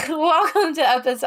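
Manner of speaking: giggling while starting the intro; laughing through it. Real, not acted. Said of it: "I'm gonna try again".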